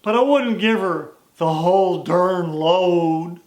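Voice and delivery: put-on slow folksy old cowboy; lightly voiced old cowhand, unhurried; cowboy drawl. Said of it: "his Western drawl".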